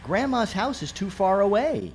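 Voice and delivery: a hint of the wife's voice. A narrator's reading. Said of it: "my wife would reply".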